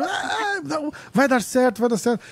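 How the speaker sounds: high, tearful mock sob; hammed-up weepy pleading; mock crying.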